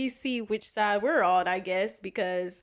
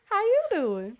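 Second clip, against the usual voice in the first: higher, different voice greeting; put-on greeting; 10 semitones higher, 3 dB louder than usual. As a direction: toss off a character's hello.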